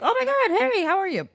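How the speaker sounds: high-pitched mock gushing hello; excited greeting. Acted excited greeting between characters.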